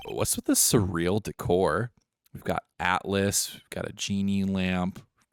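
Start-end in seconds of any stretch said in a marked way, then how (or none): none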